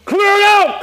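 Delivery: command shout. Real, not acted. Repeated in-game command.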